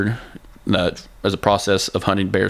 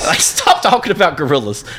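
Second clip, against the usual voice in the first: laughing while talking; 7 semitones higher, 3 dB louder than usual. Real, not acted. Laughing rant at Rogan.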